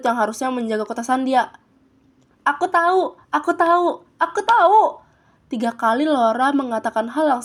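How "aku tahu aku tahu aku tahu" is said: high, repeated insistence building louder; acted impatient outburst; exasperated insistence. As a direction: insist higher, pushing the last one. Laura insists three times over.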